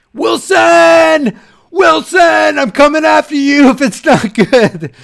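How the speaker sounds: high, loud, drawn-out yell of the name; mock threatening shout. Threatening Wilson over a film tip, mock furious shout. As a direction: holler it as a hammed-up threat.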